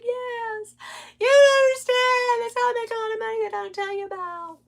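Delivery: high, tearful sobbing protest; crying reply. Retold or imitated. Answering her husband, high and crying. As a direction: plead through tears to be understood. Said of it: "I cried".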